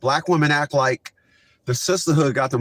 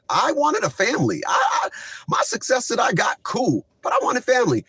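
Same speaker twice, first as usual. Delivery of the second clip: heated rant; 11 semitones higher than usual. Real, not acted. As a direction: vent it high and hard. Ranting about wanting family.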